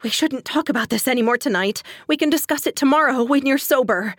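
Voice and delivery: scared, trying to put him off; anxious plea. A narrator's reading. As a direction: plead nervously, pitch climbing. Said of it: "I forced out on a shallow breath".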